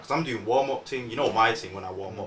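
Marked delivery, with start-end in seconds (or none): none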